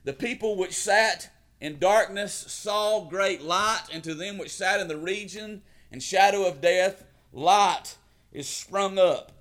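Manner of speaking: booming biblical narration. Acted. Reads Matthew four sixteen.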